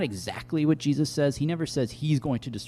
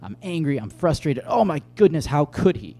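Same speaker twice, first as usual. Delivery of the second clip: rising exasperated outburst, higher and louder; enraged high priest; 5 semitones higher, 6 dB louder than usual. Retold or imitated. High priest tearing his clothes, furious outcry. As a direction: voice the priest's outrage building up. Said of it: "the high priest gets enraged".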